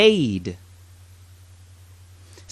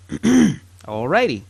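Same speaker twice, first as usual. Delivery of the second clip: ordinary talk, nothing marked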